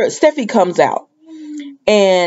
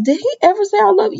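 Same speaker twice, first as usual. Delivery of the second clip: incredulous question; 12 semitones higher, much faster than usual. Retold or imitated. Mock-questioning a friend.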